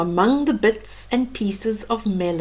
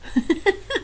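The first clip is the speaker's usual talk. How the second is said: high giggle; 10 semitones higher, 4 dB quieter than usual. Real, not acted.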